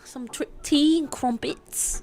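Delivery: put-on slow, hesitant English accent. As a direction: feel your way into an English accent. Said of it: "being good at English accents".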